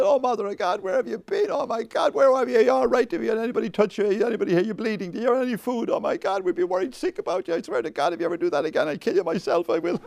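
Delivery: high, breathless-style torrent of worried questions; frantic mother. Acted. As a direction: play a hammed-up panicked mother turning to scolding. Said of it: "Mary rushed into the temple".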